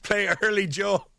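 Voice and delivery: high, put-on prim bandleader voice; prissy band frontman correcting a guest; stuffy cover band singer. Quoting the Step Brothers band, a high snooty voice. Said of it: "like that band in Step Brothers".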